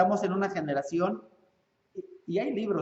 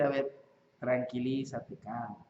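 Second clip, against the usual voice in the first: put-on lower, softer, calming the child down; calming parent; 6 semitones lower, 5 dB quieter than usual. Father calmly tells his crying child to settle down, softly. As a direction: settle the kid firmly and quietly. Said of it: "Lo que le tiene que decir".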